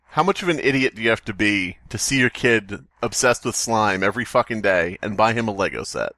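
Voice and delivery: ordinary talk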